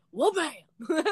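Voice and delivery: high goofy exclamation; mock Devin landing hard in a chair; imitating Devin. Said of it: "he's like".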